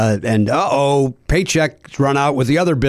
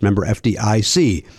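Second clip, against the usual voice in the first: usual speaking voice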